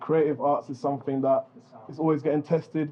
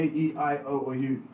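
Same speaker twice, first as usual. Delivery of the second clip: usual speaking voice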